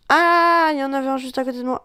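loud high cry then fast words; startled shout. Real, not acted. Startled cry at a hidden enemy. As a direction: yelp and rush the rest.

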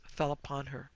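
plain reading voice

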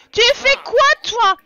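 high, loud outraged "Tu fais quoi"; a loud, high, shocked demand; outraged shout. Real, not acted. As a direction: yell in disbelief at him. Outraged at Sim's rude act.

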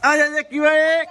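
calling out. Real, not acted. Calling the dog over.

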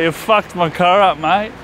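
ordinary talk, nothing marked

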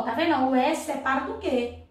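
usual speaking voice

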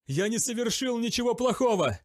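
high, loud defiant cry; defiant shout. A narrator's reading. Zachariel refuses to beg, defiant shout. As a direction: yell it in defiance. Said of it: "крикнул Захариэль".

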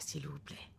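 a breathless whisper of please. Acted. Wife pleading with husband in car, whispered. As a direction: let the plea fall to a whisper.

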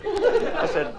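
ordinary talk, nothing marked

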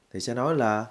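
usual speaking voice

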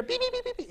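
fast electronic beeping; rapid scanner beeps. Shown as a demonstration. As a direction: chirp like a barcode scanner. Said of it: "But here".

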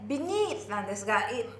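normal talking voice

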